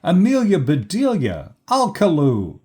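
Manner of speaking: loud, high scolding call of names; angry shout. A narrator's reading. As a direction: summon the culprits sharply. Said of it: "shouted Missus Rogers".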